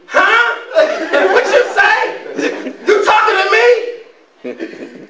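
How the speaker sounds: put-on very high-pitched, drawn-out girl's reply; girl answering catcall. Girl answering a dude who hollered, high mock-girlish. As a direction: play a girl responding, exaggeratedly high. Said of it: "you know what you girls do".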